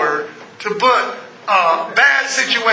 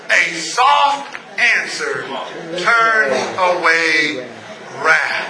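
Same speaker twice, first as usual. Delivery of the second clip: low, very slow reading; slow, low, soft recitation; soft scripture quote; 9 semitones lower, much slower than usual. Acted. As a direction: let the verse land gently. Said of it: "The Bible says".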